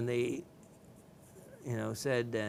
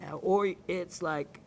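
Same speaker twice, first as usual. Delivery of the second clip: usual speaking voice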